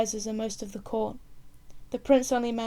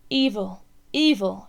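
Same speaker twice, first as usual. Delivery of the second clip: louder, falling cries of evil; shrieking; 7 dB louder than usual. Acted. Advisor denouncing the woman to the court, loud shrieks. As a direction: denounce her to the whole court. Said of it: "shrieked the advisor".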